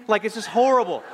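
ordinary delivery

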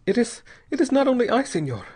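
put-on high, pleading young woman's voice; frightened young mother. A narrator's reading. Young mother pleads with Bell, high and frightened. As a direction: voice her as a terrified wife begging.

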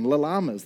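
"llamas" with an extra "la" in front; double L sounded. Shown as a demonstration. Pastor mocking the silly name, "llamas" said as "la-llamas". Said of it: "What's with that double L going on".

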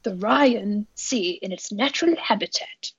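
hushed, badly faked British nature narrator; David Attenborough. Host tries a pinball call-out on co-host, amateur hushed impression. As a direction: softly attempt a British documentary voice, breaking into a laugh. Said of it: "I can't do a British accent".

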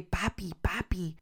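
softly choked calling; barely getting the words out; choked whisper. Retold or imitated. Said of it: "And I'm crying and trying to say".

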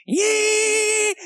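long, high-pitched engine squeal; high sustained mock tire screech; screeching vehicle imitation. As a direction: imitate a car screeching on one held note. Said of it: "the vehicle's like".

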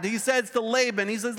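usual speaking voice

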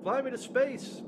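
camp it up as a movie baddie; put-on villain voice. Mocking the film villain in a high silly put-on voice.

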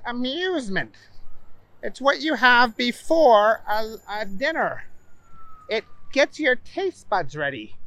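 high, emphatic explaining; know-it-all. Acted. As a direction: show off what he learned. Booger lectures on French.

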